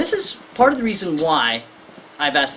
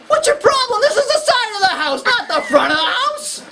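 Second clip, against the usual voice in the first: put-on high, louder, laughing indignant child; indignant kid; 4 dB louder than usual. Lecturer voicing a kid protesting to the parent, high and laughing. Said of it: "kid's sitting there going".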